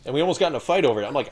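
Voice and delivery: ordinary talk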